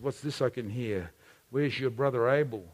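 low, soft questioning; quiet voice of God. Retold or imitated. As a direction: ask calmly, already knowing.

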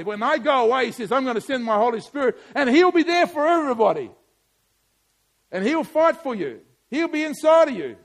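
loud, high preached quote; preaching at full force. Real, not acted. Pastor voicing Jesus to the congregation, loud preaching. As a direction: declare it to the church.

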